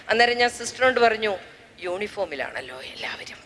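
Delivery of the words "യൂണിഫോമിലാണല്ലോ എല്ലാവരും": whispered aside. Retold or imitated. Aside to sister at funeral.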